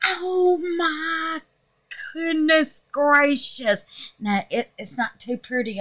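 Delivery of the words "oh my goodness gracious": higher, slow, near tears; awed. Real, not acted. Gushing over her soap, near tearful joy. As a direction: overcome with delight.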